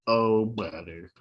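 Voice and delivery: slow, low droopy groan; droopy dog voice. Acted. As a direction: drag it out like a mopey dog. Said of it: "mine all sound like droopy dog".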